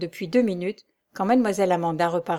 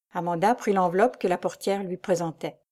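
plain reading voice

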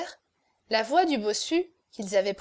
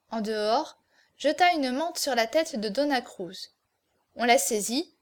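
usual speaking voice

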